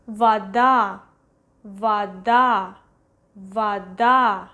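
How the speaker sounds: "Вода" said va-DA, stress on the end; "Вода" as va-DA, landing firmly on DA; unstressed O as A. Shown as a demonstration. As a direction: model "Вода" with first O as A. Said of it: "we pronounce as A".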